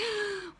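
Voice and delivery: dismayed gasp. Retold or imitated. Imagined recruit hears others' success.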